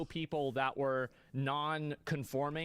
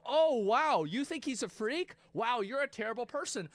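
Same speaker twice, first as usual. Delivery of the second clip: mock outrage; 7 semitones higher than usual. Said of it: "And then you go".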